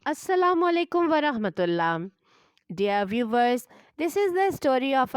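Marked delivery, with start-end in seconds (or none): none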